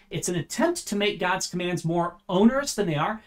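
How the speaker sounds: ordinary talk, nothing marked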